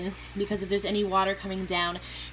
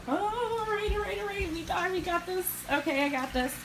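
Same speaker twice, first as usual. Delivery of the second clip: high, nervous self pep talk; psyching up; 11 semitones higher than usual. Real, not acted. Fishkeeper psyching herself up to start the refill, high nervous pep talk. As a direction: talk yourself into it.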